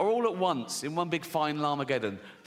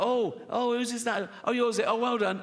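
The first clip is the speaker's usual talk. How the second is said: higher-pitched mock cooing over a baby; feigned delight; 7 semitones higher than usual. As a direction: put on polite delight, pitched up.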